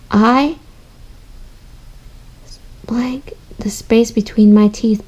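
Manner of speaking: whispering the line to herself; thoughtful rereading. Shown as a demonstration. Teacher rereading to work out the missing word, whispered. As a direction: whisper it while puzzling it out. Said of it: "go back and read again".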